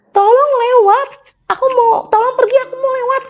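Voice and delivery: high, pleading yell to get past; desperate shout. Retold or imitated. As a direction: scream at the ghost to move aside. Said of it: "Sambil kayak aku teriak".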